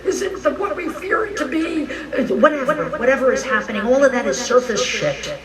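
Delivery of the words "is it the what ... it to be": high-pitched fretful questioning; mock worry, pitched up high; anxious internet searcher. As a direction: fret aloud over search results. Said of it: "I'm on the internet and I'm looking up".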